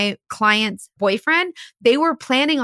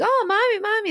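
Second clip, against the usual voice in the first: put-on high childlike calls; little kids calling; 10 semitones higher than usual. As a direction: call out like a small child on the field. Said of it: "they're like".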